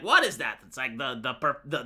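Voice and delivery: ordinary talk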